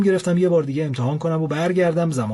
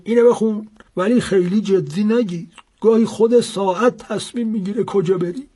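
put-on higher and louder old man; old seller's voice; 8 semitones higher, 5 dB louder than usual. A narrator's reading. Old seller to the buyer, higher and louder. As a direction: hand it over with a knowing old voice.

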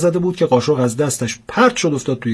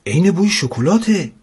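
put-on higher-pitched eager little girl; delighted child; 6 semitones higher than usual. Schoolgirl sniffing the cake as it bakes, delighted. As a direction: pipe up with excited delight.

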